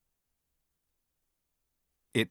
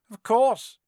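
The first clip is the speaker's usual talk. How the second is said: high, crisp dismissal; cool; 11 semitones higher, 5 dB louder than usual. A narrator's reading. Quirrell confirms it to Harry, cool. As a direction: toss it off coolly. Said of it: "said Quirrell coolly".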